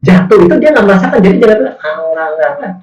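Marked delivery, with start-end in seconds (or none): 1.8-2.7 put-on high, whiny child's protest; stubborn child. Child brushing off parent's warning, high whiny protest. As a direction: whine it off like a child who won't listen.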